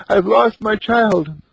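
put-on keen over a lost child; mother crying in her hut without looking up, wailing; sobbing mother. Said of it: "the mother continued sobbing".

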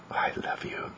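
very soft, tender whisper. Acted. Owner cuddling the dog close, whispering. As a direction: whisper it lovingly, barely any voice.

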